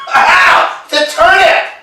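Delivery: high, loud indignant shout; outraged cry. Acted. Biting a turnip instead of a carrot, an outraged high shout. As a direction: yell it, cheated by the turnip.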